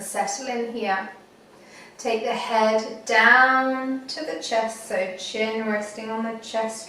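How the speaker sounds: unhurried meditation guide, long pauses; guided meditation. Acted. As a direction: talk them gently through it, phrase by phrase.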